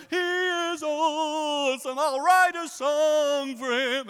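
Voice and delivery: very slow, high, loud rapture; mock gushing praise. Believer gushing after things work out, slow and high. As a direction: ham up the starstruck fan. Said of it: "you come".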